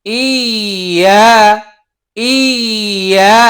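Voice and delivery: ইয়া drawn out slowly with a light doubled ই-য়া; gently rubbed iya. Shown as a demonstration. Teacher showing the correct doubled ইয়া, slow and gentle. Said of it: "লক্ষ্য করি সবাই".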